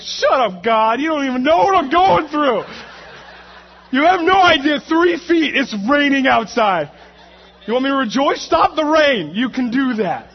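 high, loud shouted protest; hammed-up exasperation, yelling up at God; angry rant at God. Retold angry rant back at God.

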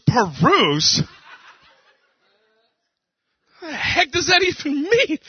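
high, squeaky disbelief; husband baffled by his wife's fancy word, hammed-up high; mock baffled. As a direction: exaggerate the confusion. Mock baffled retort to wife.

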